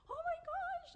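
high-pitched, loud shriek of shock; acted-out outburst, very high and loud; retold scream. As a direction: shriek it as if reliving the moment. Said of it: "I just started crying and screaming".

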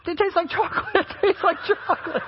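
high-pitched imitation of a surprised student; mock astonished discovery. Student tasting the supposed moose poop, imitated amazement.

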